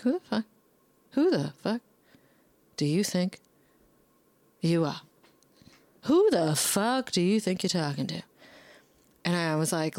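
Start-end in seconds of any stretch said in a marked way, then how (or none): 0.0-8.2 angry retelling. Retold or imitated. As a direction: confront her, heated. Retold confrontation.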